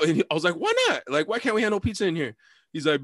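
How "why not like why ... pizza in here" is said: high-pitched indignant question; protesting. Retold or imitated. Passenger challenges the Uber driver's pizza ban, protesting. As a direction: demand a reason, pitch jumping up.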